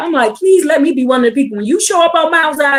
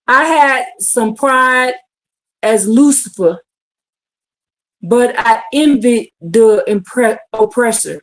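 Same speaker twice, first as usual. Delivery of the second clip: slow quote; 3 semitones lower, slower than usual. Retold or imitated. Quoting a slow testimony.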